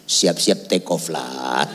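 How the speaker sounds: low, slow, soft send-off; mock solemn answer. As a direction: drop deep and draw it out. Mock answer to very old pastor.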